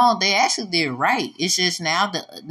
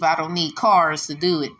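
ordinary talk

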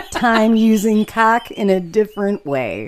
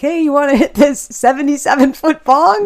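higher, louder mock college pitch; eager offer; 7 semitones higher, 5 dB louder than usual. College friends offering a bong hit, eager and loud. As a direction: imitate a hyped-up friend egging someone on.

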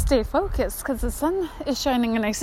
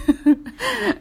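high laugh almost crying; burst of laughter; 11 semitones higher, 4 dB louder than usual. Real, not acted. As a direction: laugh out loud. Laughing at mom story.

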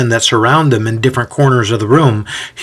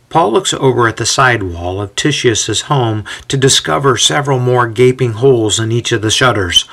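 plain reading voice

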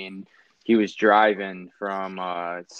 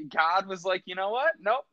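a hint of a lofty God voice, higher and laughing; imitating God; 10 semitones higher than usual. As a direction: lightly play God sparing him, chuckling. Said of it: "He's like".

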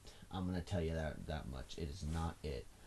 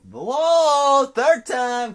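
loud, high burst of surprise; startled whoa; 15 dB louder, much slower than usual. Real, not acted. Vlogger catching his own third swear: loud startled whoa.

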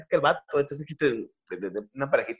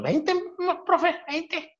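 put-on child voice; 13 semitones higher, 5 dB quieter, much slower than usual. Pepito answers teacher.

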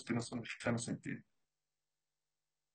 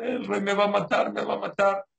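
imitating panicked crying; 7 semitones higher, 6 dB louder than usual. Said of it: "empezó a llorar".

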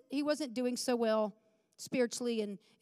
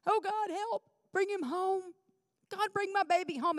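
high-pitched, pleading retold prayer; crying out; 6 semitones higher than usual. Mother begging God for her runaway son, retold tearful high plea. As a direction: re-enact a desperate plea without raising the volume. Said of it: "crying out to God".

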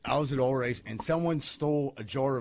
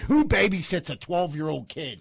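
mock outrage; 3 semitones higher, 6 dB louder, slower than usual. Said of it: "He said".

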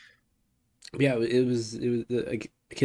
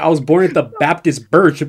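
loud, higher quote through laughter; laughing mock quote; 5 semitones higher, 10 dB louder than usual. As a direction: crack up while quoting it loudly. Cracking up while quoting someone.

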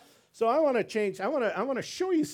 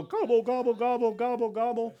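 higher, laughing gobble gobble; playful turkey imitation; turkey gobbling; 7 semitones higher, 3 dB louder than usual. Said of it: "the other one's, you know".

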